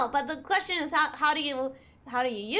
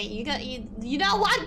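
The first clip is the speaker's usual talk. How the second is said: ordinary delivery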